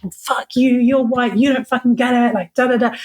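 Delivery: mock tirade, tipping toward laughter; retold angry rant. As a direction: re-enact lashing out at the listener. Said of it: "and so angry".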